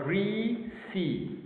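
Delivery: "greasy" slowly split into gree and sy; syllable by syllable. Shown as a demonstration. Teacher showing first and second syllable, syllable by syllable. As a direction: hold the first syllable of "greasy" long, then add sy. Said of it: "First syllable".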